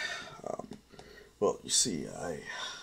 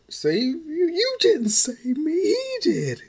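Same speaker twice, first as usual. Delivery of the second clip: put-on much higher girl voice, sharply indignant; a niece snapping at her uncle, pitched way up; indignant young girl; 5 dB louder, slower than usual. Melissa voiced by narrator.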